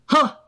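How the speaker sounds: sharp high loud huh; a loud scornful huh; scornful grunt. A narrator's reading. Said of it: "grunted Sir Handel".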